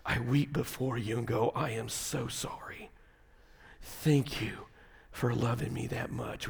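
soft, low, humble prayer voice. Shown as a demonstration. Preacher modelling a prayer of repentance, soft and humble. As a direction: confess quietly before God. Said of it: "and just go".

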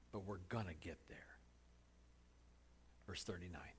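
hushed aside to the congregation; whisper. Real, not acted. Preacher whispering an aside to the congregation. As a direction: drop to a breathy whisper.

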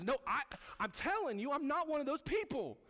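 high, loud frantic denial; panicked denial. Retold or imitated. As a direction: deny it in a rising panic.

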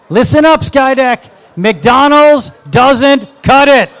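loud, high preacher shout; booming exhortation. Real, not acted. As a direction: call it out to the room.

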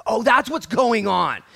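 high, slow exclamation of insight; loud realization. Acted. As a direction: call it out as it finally clicks. Asaph's realization acted out big.

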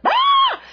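very high, loud mock scream of frustration; frustrated scream. Wives venting frustration at husbands, short mock scream. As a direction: let out a short burst of exasperation. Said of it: "sometimes when we feel like we just need to".

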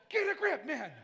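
high, loud friend snapping him out of it; exasperated friend telling Pastor to get a grip; mock scolding. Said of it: "you're gonna be like".

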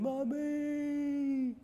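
put-on child calling out. Boy calling mother.

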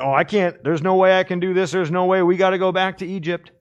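high, loud, panicked complaint; hammed-up faithless spies; whining doubters. The ten spies refusing to go on, loud mock whine.

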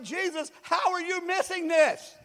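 high, building shout of disbelief; exasperated yelling. Acted. Aggravated believer to sleeping Jesus, exasperated high shout. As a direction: plead loudly, stretching it into a final yell.

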